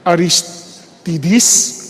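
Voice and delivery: "Aristides" with the third syllable as "tee"; "tee" pronunciation. Shown as a demonstration. Preacher showing one pronunciation of a name, "Aristides" with "tee". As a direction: say "Aristides" as a-ris-TEE-dees, slowly. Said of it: "iba-iba yung pronunciation".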